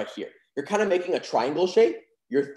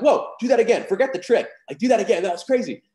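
higher, louder amazed Whoa, do that again; amazed fan; 7 semitones higher, 5 dB louder than usual. Retold or imitated. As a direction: gush like a wowed spectator. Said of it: "they're like".

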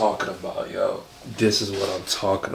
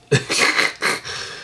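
short wheezy snicker; stifled laugh at your own slip. Real, not acted. Reviewer amused at almost miscalling Tyler LeBron, snickering.

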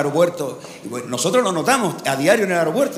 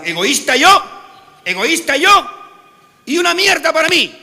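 high, louder mock indignation; indignant Catalan; 10 semitones higher, 8 dB louder than usual. Comedian voices a Catalan protesting he is no egoist. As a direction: protest while keeping it for yourself. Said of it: "Y dijo el catalán".

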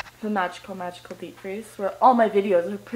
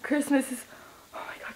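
excited whisper; 3 semitones higher, 9 dB quieter, faster than usual. Real, not acted.

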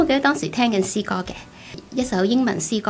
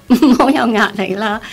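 laughing at himself, louder; self-mocking laugh; 2 semitones higher, 9 dB louder than usual. Real, not acted. Mocking his own wish for no pressure, with a laugh. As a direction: laugh through it at your own weakness.

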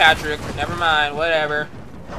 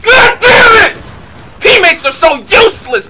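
raging yell; 13 semitones higher, 8 dB louder than usual. Real, not acted. Rage at teammates.

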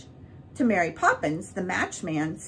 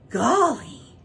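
high, loud amazed exclamation; awestruck; 7 semitones higher, 6 dB louder than usual. A narrator's reading. Bert stunned by Mary's look, awestruck. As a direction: gasp it out in wonder. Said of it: "he said it".

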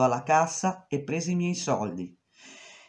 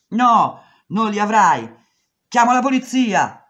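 angry shouting; 7 semitones higher, 9 dB louder than usual. Retold or imitated. Shouting match with boss.

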